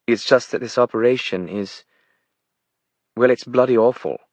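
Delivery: blunt verdict in an aristocratic British voice; well-bred British woman, voice lifted; aristocratic British accent. A narrator's reading. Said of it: "aristocratic British accent".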